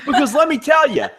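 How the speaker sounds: high, laughing mock wrestler threat; mock outraged promo. Host fires back at the cat joke, hammed-up laughing wrestler threat. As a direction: ham up the indignant heel promo.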